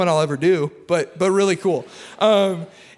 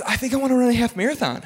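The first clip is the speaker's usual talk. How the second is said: quicker, higher quote of his wife, less put-on; wife retold; 6 semitones higher, faster than usual. Wife announcing her race plan to husband, brisk light imitation. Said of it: "My wife doesn't talk like that. But, like, she, uh, she goes".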